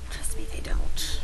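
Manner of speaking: slow conspiratorial whisper; whisper aside. Real, not acted. To the viewers about non-readers, a slow whisper. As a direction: lean in and whisper it.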